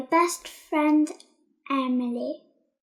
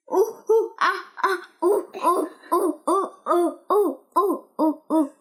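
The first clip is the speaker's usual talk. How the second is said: playful ooh ooh ah ah monkey calls; child hooting like a monkey; monkey hoots. Pretend. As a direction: hoot like a little monkey. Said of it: "I think he sounds like".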